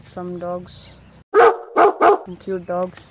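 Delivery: slow, drawn-out doting voice; soft cooing over dogs. Retold or imitated. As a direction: coo it like spotting puppies. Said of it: "that was in the second video".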